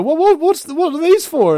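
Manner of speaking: high, stammering puzzled question; puzzled aliens. Retold or imitated. Aliens in a meme asking about masks, puzzled. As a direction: ask it high, lightly mock-baffled.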